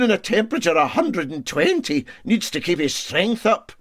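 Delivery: a hint of a self-important engineer on his high horse; aggrieved Scots engineer. A narrator's reading. Engineer's grumbling speech.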